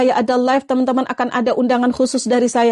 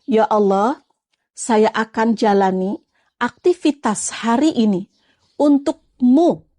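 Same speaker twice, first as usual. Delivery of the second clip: slow prayerful affirmation, untukmu drawn out long; a slow, deliberate morning prayer to repeat after me; 3 semitones lower, slower than usual. Shown as a demonstration. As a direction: savour each word of the prayer slowly, stretching untukmu. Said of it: "katakan gini".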